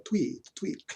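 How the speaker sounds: put-on bird tweet with a French accent. Traveler imitating the birds over there, in a mock French accent. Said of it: "birds were tweeting with a French accent".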